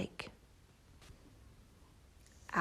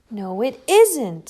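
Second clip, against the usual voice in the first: louder, slow snap; snapped; 9 dB louder, slower than usual. Acted. Shelley snapping at the teasing bird, slow snap. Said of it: "snapped Shelley".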